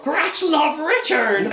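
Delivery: a simple beast pouring out its love; gargoyle voice. Retold or imitated. Gratch greeting Richard again, high slow creature cry. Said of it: "He's like".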